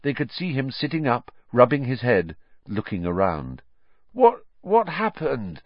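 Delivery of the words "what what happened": high, slow dazed question; groaning. A narrator's reading. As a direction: groan it on just waking. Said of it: "he groaned".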